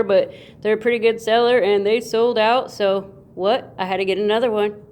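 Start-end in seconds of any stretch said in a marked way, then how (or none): none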